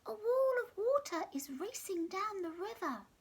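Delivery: high squeaky alarm; squeaky giraffe. Acted. Giraffe squeaking warning of the flood. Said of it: "surprisingly squeaky voice".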